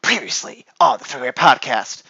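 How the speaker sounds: dramatic movie-trailer narrator voice; trailer announcer. Acted. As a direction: intone it like a cheesy TV recap.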